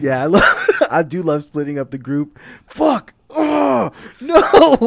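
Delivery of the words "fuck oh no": high, loud fake despair; mock dismay. Caught loving splitting the group, mock wailing. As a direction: cry out in playful horror.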